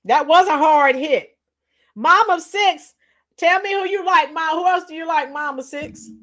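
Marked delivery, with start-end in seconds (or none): none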